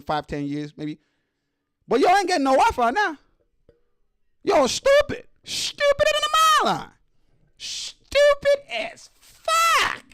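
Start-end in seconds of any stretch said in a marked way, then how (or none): 1.9-3.2 high, heated telling-off; scolding. Real, not acted. Host telling the girls off, scolding. 4.4-10.0 high-pitched outraged shout; angry shouting. Real, not acted. Host berating girls who attacked their mom, angry shouting.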